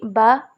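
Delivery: बा drawn out slowly as the sound of B; letter sound demo. Shown as a demonstration. Teacher explains the consonant sound in bat, sounding out बा. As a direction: sound out बा, a b with an open vowel. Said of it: "ये भी pronunciation जो होता".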